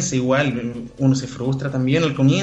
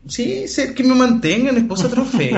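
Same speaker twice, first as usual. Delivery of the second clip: mock trophy wife; 9 semitones higher than usual. Jokes about being a trophy wife.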